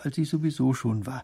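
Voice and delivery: ordinary delivery, nothing marked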